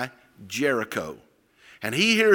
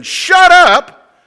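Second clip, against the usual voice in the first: very loud, high shout; shouted order; 12 dB louder than usual. Retold or imitated. Crowd to the blind man, shouting him down. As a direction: yell to silence him.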